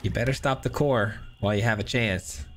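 ordinary talk

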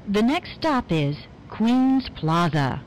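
ordinary delivery

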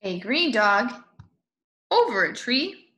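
ordinary delivery

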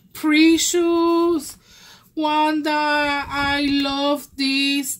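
can't contain the excitement, drawn out; excited squeal. Real, not acted. Crafter gushing to her friend Wanda over the gift, excited and loud. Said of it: "I can't even yell".

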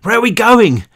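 high, loud call over the noise. A narrator's reading. Brick calling to Cal over the din, loud high shout. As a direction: yell a question over a helicopter. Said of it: "He could hear Brick calling out".

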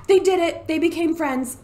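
high, loud gasp then cheer; thrilled real gasp; excited gasp. Real, not acted. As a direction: gasp and celebrate the win. Sims finally become friends.

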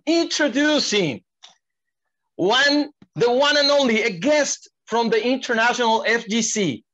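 high, booming ring announcer; lucha libre announcer. Acted. Hypes the guest's entrance.

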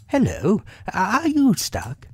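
lifted storybook animal, curious; Rabbit. A narrator's reading. Rabbit finding Pooh in the doorway, high questioning voice.

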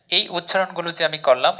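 normal talking voice

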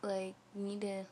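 demonstrating flossing at teeth; talking with fingers on lip. Real, not acted. Seen 2: Fingertips press lower lip down over braces throughout the words.

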